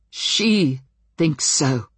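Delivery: very slow and deliberate; slow heavy words. Acted. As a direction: drag each word out slowly. Ben tearful, pinned down.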